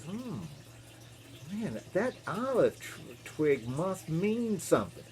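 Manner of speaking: soft, slow musing; quietly puzzling it out as Noah; hushed pondering. Acted. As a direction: think it through slowly under your breath. Noah puzzles over the twig.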